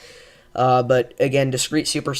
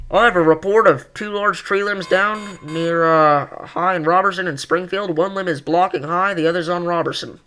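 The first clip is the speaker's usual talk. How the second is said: radio voice; 4 semitones higher than usual. Retold or imitated. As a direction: read it like a ham radio operator. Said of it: "I'm gonna read this in a radio voice".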